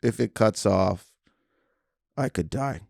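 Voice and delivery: lightly imitated calm magician; David Blaine. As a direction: announce danger in mock-solemn calm, with pauses. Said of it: "calm about it".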